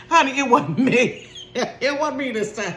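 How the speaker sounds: high laughing protest; laughing denial. Real, not acted. Mom protesting innocence to viewers, laughing. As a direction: giggle out your innocence.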